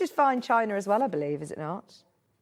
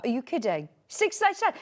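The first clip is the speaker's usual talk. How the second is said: ordinary delivery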